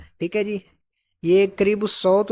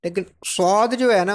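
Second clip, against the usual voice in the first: usual speaking voice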